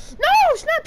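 very high, loud no; shocked yell. Real, not acted. Gamer yelling as an opponent escapes, high shocked yell. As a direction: cry out in dismay. Loses a grab, yells.

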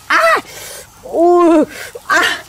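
sharp high yelps of pain from hot food; burned yelps. Real, not acted. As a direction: yelp as your fingers get burned. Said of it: "Bỏng vãi chưởng".

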